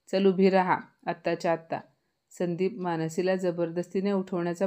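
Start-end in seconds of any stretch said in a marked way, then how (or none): none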